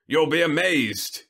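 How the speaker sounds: high, loud gleeful boast; chortling. A narrator's reading. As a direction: crow it with glee. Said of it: "he chortled".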